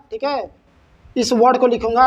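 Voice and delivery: ordinary talk, nothing marked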